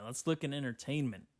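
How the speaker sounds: ordinary delivery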